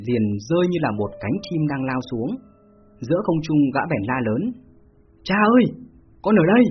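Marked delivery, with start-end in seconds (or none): 5.2-6.6 put-on high, loud girlish call for father; shouting like a young girl calling her father; faked girl's voice. A narrator's reading. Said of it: "gã giả giọng con gái".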